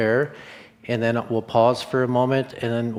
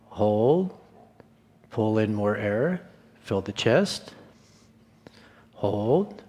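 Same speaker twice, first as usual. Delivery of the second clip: guided breathing; slower than usual. Acted. Breath coach leading the group through holds, calm counting.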